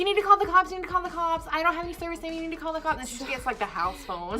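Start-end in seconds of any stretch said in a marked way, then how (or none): 0.0-3.0 high, frantic pleading; mock panic. Frightened friends beg for a police call, mock panic. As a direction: urge it over and over like a panicked friend.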